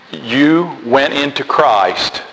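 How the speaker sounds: ordinary talk, nothing marked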